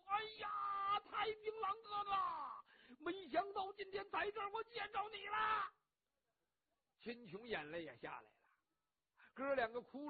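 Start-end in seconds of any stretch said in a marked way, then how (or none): 0.1-5.7 high, loud sobbing wail; wailing cry. Acted. Cheng Yaojin grabs his long-lost brother and wails. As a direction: bawl it out with open mouth. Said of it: "咧着嘴就哭".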